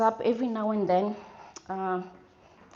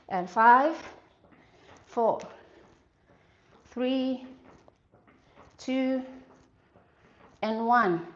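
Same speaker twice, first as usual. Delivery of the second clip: countdown; 2 semitones higher, 4 dB louder, much slower than usual. Real, not acted. Counting down the reps.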